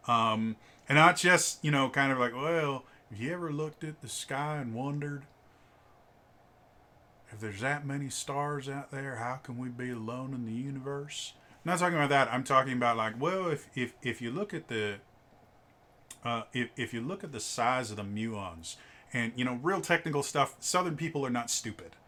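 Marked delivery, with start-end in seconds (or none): 2.3-11.3 soft, musing Southern drawl. Acted. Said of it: "a Southern drawl". 13.2-18.8 lightly put-on Southern drawl. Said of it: "a Southern drawl".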